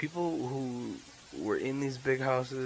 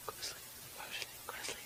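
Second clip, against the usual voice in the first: whispering; 16 dB quieter, much faster than usual. Pretend. Telling himself to sleep.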